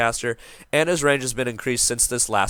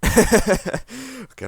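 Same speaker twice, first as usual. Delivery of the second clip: laughing; 11 semitones higher than usual. Real, not acted. Laughs at own bit.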